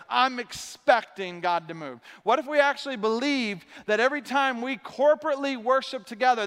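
full-force preaching. Acted. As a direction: build it like a sermon climax.

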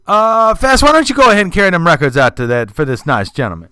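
high, loud put-on voice of the shop owner. Record shop owner calls to [name], high and loud. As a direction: call out high and loud to the janitor. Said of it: "the owner of the record shop sits there and says".

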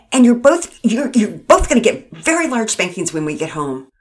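loud, sputtering scolding; angry dressing down. Acted. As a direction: chew them out, furious and flustered. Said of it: "Mama Peters sputtered".

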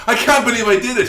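high, fast, thrilled burst; giddy after a gig; excited. Retold or imitated. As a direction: blurt it out, buzzing with adrenaline. Excited retelling of post-show moment.

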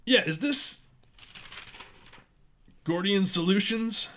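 lightly voiced gruff woman caller; a hint of a gruff woman; gruff woman's voice. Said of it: "a gruff, uh, feminine voice say".

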